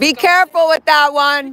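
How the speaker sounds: high, loud holler; projected heads-up; called-out warning. Real, not acted. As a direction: shout a warning across the street. Calling warning to departing friend.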